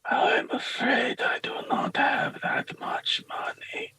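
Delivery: slow, regretful whispered voice; whispering priest. Acted. Poor priest declines the peddler's price, slow whisper. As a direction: whisper it slowly and apologetically. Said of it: "starts to murmur something to himself".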